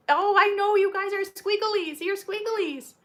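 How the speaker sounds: high fond coo at squirming puppies; puppy talk. Real, not acted. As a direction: tease the wriggly puppies fondly. Cooing at wriggling puppies.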